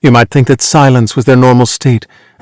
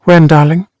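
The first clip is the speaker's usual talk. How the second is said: higher-pitched, light feminine question; questioning wife; 4 semitones higher than usual. A narrator's reading. Wife asking her husband what he means, light questioning.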